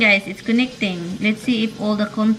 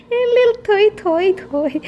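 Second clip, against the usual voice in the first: laughing; 11 semitones higher, 4 dB louder, slower than usual. Real, not acted. Bursting out laughing.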